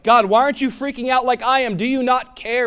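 loud, high panicked cry to God; frantic, high and loud plea. Retold or imitated. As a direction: shout it as if terrified. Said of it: "You ever done that? Like".

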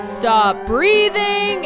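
normal talking voice

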